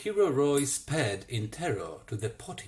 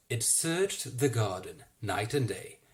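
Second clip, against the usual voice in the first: usual speaking voice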